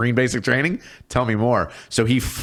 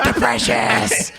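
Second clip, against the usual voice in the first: slow, much higher whispered creature hiss; Gollum; much slower than usual. Retold or imitated. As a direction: breathe it out like a greedy little creature, laughing through it. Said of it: "Hey, Golem".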